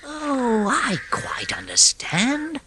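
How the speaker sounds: soft, slow, gentle comfort; calm a scared friend; soothing. A narrator's reading. Rat soothing the frightened mole, gentle. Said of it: "said the rat soothingly".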